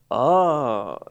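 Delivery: a long, drawn-out "oh" of realizing; dawning realization. Retold or imitated. Friend finally catching on to the news, dawning realization. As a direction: imitate the penny slowly dropping. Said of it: "it's starting to dawn".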